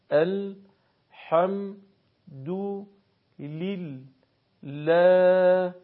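very slow, long vowels held steady; measured beats with clear gaps between syllables; syllable-by-syllable recitation. Shown as a demonstration. Teacher models careful Arabic recitation, slow syllabic chanting. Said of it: "بخش خوانی بکنید و ضرب خوانی".

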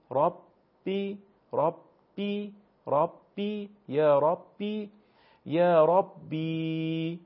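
ربی with the final ī held long and high; drawn-out long vowel. Shown as a demonstration. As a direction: chant out ربی slowly, stretching the last vowel. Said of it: "با حالت کشیده میگین". (ربی با یای مدی کشیده)

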